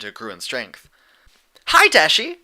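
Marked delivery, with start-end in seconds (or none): 1.7-2.4 very high, loud, bouncy Pinkie voice; cheerful call. Acted. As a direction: chirp it out like an excited pink pony. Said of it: "Pinkie called".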